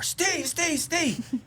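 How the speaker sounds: high calling out of a frightened neighbor through the door; a neighbor wakes dad at one A M, calling his name high; retold calling out. Mock frantic call at the door, high-pitched.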